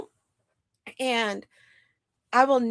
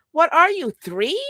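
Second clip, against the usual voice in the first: pitched up, louder scornful jab; mocking disbelief; 8 semitones higher, 5 dB louder than usual. Real, not acted. Scoffing at a toddler-like apology, pitched-up mock disbelief.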